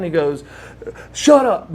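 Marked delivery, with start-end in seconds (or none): 1.1-1.7 high, loud, abrupt retold yell; mock squawk. Friend on the phone before hanging up, angry squawk. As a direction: cut the caller off with a raised squawk. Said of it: "I hear this squawking going on".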